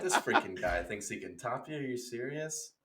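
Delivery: soft incredulous aside to a star; hushed disbelief. Acted. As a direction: scoff under your breath. Panel confides in Chris Pratt.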